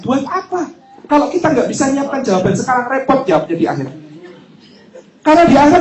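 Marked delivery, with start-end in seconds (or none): 0.1-0.7 higher, weighty question; questioning voice of God. Pretend. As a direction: ask it from above. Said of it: "Allah tanya".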